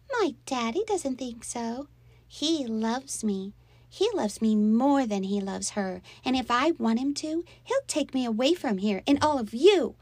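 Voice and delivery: put-on high, pouting child voice; spiteful little girl. A narrator's reading. Little girl boasting of her daddy's love, spitefully. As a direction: insist like a possessive child.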